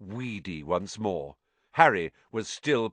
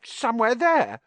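Myrtle; 14 semitones higher, 6 dB louder than usual. Acted. Myrtle pointing.

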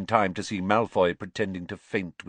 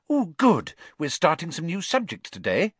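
bright and eager, higher; happy; 9 semitones higher, 4 dB louder than usual. A narrator's reading. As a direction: chirp it out happily. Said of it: "she said happily".

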